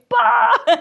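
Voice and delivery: a high, loud imitated gasp of the whole class; crowd's gasp of shock. The whole class reacts to her reply to the teacher, mock shocked yelp. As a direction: yelp it like a room of stunned students. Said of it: "tout le monde a fait".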